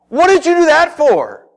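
loud, high-pitched acted protest; indignant outburst. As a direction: confront her in loud, high outrage. Indignant disciple confronts the woman.

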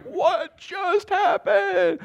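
high, very slow mock shout; stunned. Walker after hawk grabs a rabbit, drawn-out stunned cry. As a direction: stretch it out in comic astonishment.

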